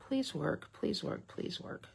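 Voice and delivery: pleading under her breath that the stamp works; whispered plea. Real, not acted. Hoping the stamp comes out, she whispers a plea. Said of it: "join me in a moment of prayer".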